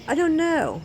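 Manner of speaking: high-pitched exasperated protest; fed up with being questioned, pitch jumps up; exasperated retort. Retold or imitated. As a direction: throw it back, high and exasperated. Acted reply to pestering questions.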